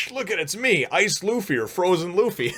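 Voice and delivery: high-pitched goofy show-off; high, excited mock character voice; playful Luffy. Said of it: "does this funny thing where he's like".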